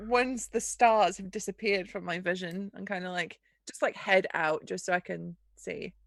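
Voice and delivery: usual speaking voice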